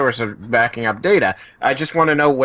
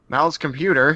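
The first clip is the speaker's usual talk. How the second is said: ordinary talk, nothing marked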